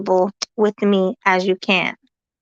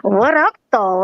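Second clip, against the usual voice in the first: Jersey accent; 3 semitones higher than usual. Shown as a demonstration. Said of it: "put my Jersey accent on".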